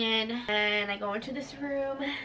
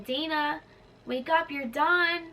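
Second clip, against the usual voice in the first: high, slow sing-out wake-up call; waking someone; 5 semitones higher, slower than usual. Retold or imitated. Nurse to patient coming out of anesthesia, waking someone. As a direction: imitate a nurse rousing a patient, high and drawn out.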